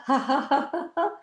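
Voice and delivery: high rapid ha-ha; forced laugh, climbing in pitch; laughter. Shown as a demonstration. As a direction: shake out a deliberate laugh. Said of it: "Shake it up with laughter".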